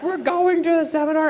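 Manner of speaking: high, laughing singsong insistence; mock insistent wife. Wife insisting on the seminar, high laughing voice. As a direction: chirp it triumphantly.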